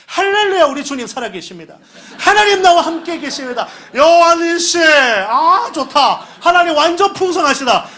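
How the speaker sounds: high, loud, laughing praise; giddy joy. Retold or imitated. Pastor retelling his thrill at the bank balance, high and laughing. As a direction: overflow with delight.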